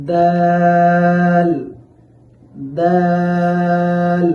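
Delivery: teacher chanting দাল slowly; drawn-out letter demo. Shown as a demonstration. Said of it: "যেমন".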